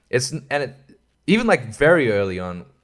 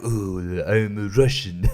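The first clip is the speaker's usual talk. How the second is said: slow, low mock-Russian voice; bad Russian accent; 4 semitones lower, slower than usual. Imitating the film's villain, bad Russian accent. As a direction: put on a clumsy Russian accent. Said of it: "I can't do a Russian accent".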